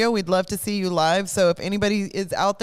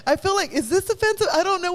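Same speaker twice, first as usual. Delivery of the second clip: laughing; 9 semitones higher, faster than usual. Real, not acted. Host cracks up at bit.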